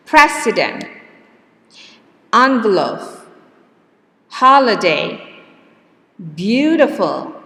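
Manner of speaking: President, envelope, holiday said loud with the first syllable stressed; stress dictation. Shown as a demonstration. Teacher dictating the second row to class. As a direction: hit the first syllable of each word. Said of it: "where the stress is?".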